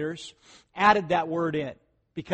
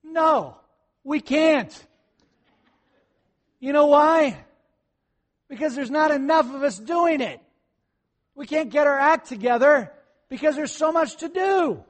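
loud, high-pitched emphatic comeback; exasperated retort; 9 semitones higher, 7 dB louder than usual. Acted. Preacher answering a critic of the church's chaos, exasperated and loud. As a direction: fire back at the critic, raised voice.

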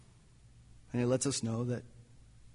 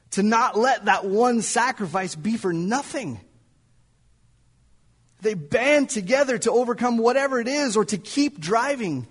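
higher, louder, building preacher voice; preaching; 7 semitones higher, 8 dB louder than usual. Acted. Preacher rallying the congregation about the cross, preaching. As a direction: drive it home to the congregation.

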